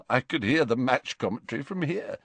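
usual speaking voice

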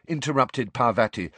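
ordinary delivery, nothing marked

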